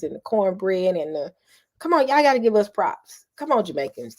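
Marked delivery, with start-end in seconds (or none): 1.8-4.2 playful call-out. Real, not acted. Calling out Jamaicans.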